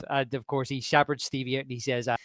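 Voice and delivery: ordinary talk, nothing marked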